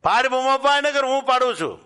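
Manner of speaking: bold challenge shouted at full force; manly shout. Acted. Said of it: "અને મર્દાનગીમાં કહ્યું કે". (મર્દાનગીભર્યો ઊંચો પડકાર)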